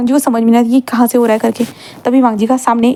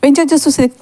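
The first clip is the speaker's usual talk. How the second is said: usual speaking voice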